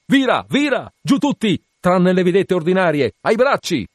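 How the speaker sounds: high, loud commands to the crew; shouted orders. Acted. Captain commanding the crew to tack, loud shouted orders. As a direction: bark the orders across the deck.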